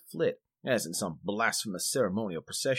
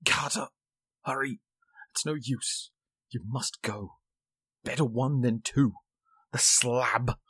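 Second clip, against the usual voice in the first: whisper; 3 semitones higher, slower than usual. A narrator's reading. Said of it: "his next whisper".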